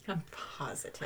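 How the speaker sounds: mock-serious whisper. Host teasing co-host about dinosaurs, whispered. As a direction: breathe it like a secret.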